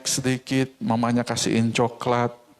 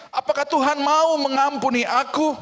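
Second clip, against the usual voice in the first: anxious questioner; 11 semitones higher than usual. Acted. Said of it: "jangan lagi bertanya".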